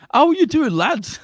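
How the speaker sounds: high, chirpy British lad voice, not Russian; British lads accent. Retold or imitated. Mocking Death of Stalin actors, high British lad accent. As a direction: greet them like a cheery British lad. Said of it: "expecting Russian accents, but it's like".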